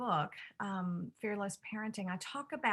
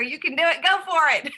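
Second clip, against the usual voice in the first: loud, high cheering on; rally him like a coach from the sidelines; excited cheer; 7 semitones higher, 11 dB louder than usual. Retold or imitated. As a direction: kid retelling a cheer at full volume. Son quotes Jesus cheering him.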